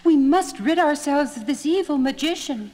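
high, bright princess voice calling; relieved princess. Acted. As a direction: call him with eager relief. The princess greets Aladdin.

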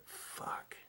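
breathy whispered swear under the breath; whispered curse. Retold or imitated. Alone on the road, he whispers the curse. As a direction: mutter it to yourself, voiceless. Alone seeing distant lighthouse.

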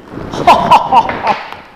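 loud, breathy monkey laugh; playful monkey laugh; monkey cackle. Acted.

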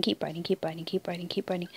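soft, tense chant under the breath; urgent whisper. Real, not acted. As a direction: egg the dog on in a hush.

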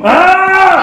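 high, loud shout; loud yell. Real, not acted. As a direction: roar it out with him. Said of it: "Juice. Come on".

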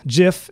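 GIF said like the brand "Jif"; soft G. Shown as a demonstration. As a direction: start gif with a j sound, as in "jiff". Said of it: "gif is a peanut butter".

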